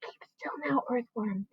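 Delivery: breathy unvoiced whisper; urgent whisper to keep still. A narrator's reading. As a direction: hush him so the bird won't hear. Hushing a frightened friend.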